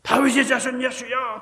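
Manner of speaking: crying out loud and high to be heard; desperate shout. Retold or imitated. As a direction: call out to Jesus with all your breath. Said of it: "그런데도 그냥 계속 소리 지르는 거예요".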